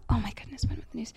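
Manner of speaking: whispered, very soft self-questioning; muttered aside. Real, not acted. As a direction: whisper to yourself while recalling. Asking herself, trying to recall.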